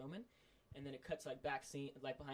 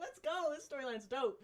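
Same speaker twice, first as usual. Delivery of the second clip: hyped cheer; 12 semitones higher, 5 dB louder, slower than usual. Retold or imitated. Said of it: "I was like".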